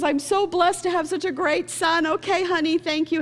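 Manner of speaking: high-pitched, mock-tearful mom; imitating tearful mother. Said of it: "she's crying while she's listening".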